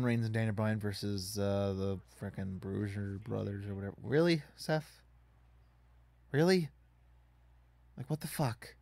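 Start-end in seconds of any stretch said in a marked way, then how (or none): 4.0-8.8 exasperated. Real, not acted. Exasperated at opponent.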